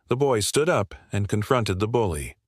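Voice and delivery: usual speaking voice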